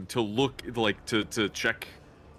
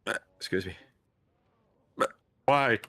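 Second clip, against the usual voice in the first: hiccuping between words; talking through hiccups; 3 semitones higher, 4 dB louder than usual. Real, not acted. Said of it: "I got the hiccups".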